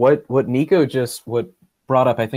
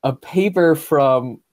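incredulous, rising; 5 semitones higher, 4 dB louder, slower than usual. Retold or imitated. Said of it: "I was just like".

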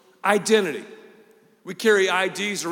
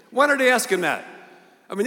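ordinary delivery

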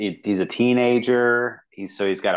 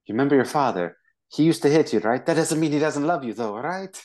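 a touch higher, fast, leading questions; coaxing leader; 6 semitones higher than usual. Retold or imitated. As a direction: coax him toward tears, slyly. Leader coaxing kid before slap.

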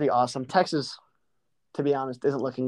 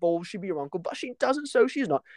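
high-pitched mock British fan rant; hammed-up indignation, climbing higher in pitch; angry Man United fan; 6 semitones higher than usual. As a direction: imitate an exasperated English football fan. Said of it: "he's so angry. He goes".

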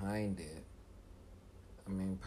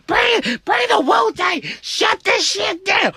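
hysterical high screams for backup; screaming; 17 dB louder than usual. Acted. Kid phoning mom for rescue, screaming in panic. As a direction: scream frantically down the phone, building in desperation. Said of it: "Stop screaming".

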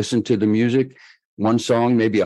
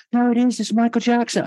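much higher put-on voice of the caller; a shy superstar caller voiced far higher; 13 semitones higher than usual. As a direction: pitch way up to play the famous caller on the phone. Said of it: "this voice goes".

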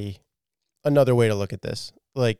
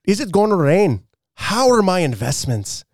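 hammed-up, higher-pitched Scottish reading; Scottish accent; 4 semitones higher than usual. Shown as a demonstration. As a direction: read it in an amateur Scottish accent. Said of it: "can you read this in a Scottish accent".

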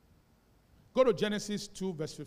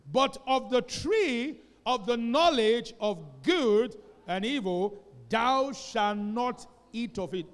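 loud, slow, measured recitation of scripture; scripture reading; 4 semitones higher, 8 dB louder, slower than usual. Real, not acted. Pastor leading the church in reading Genesis, loud and slow. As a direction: lead the whole church through the verse.

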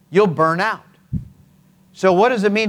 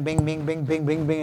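quick, softer string of bings; light, repeated pinging; rapid ricochet noises; 5 dB quieter than usual. Shown as a demonstration. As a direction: imitate a ball bouncing bumper to bumper. Imitates ball ricocheting off bumpers.

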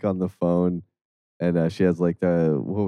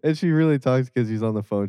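ordinary talk

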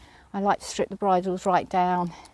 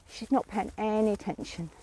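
normal talking voice

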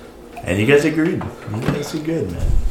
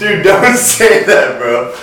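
laughing; 8 semitones higher, 3 dB louder, slower than usual. Real, not acted. Friend makes an awkward remark.

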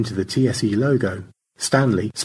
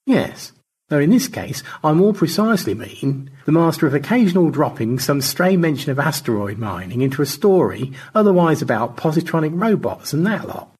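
a hint of a higher, fussy old gent; lightly voiced old gentleman; 7 semitones higher than usual. A narrator's reading. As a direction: whimsical elderly gentleman, amused. George's voice.

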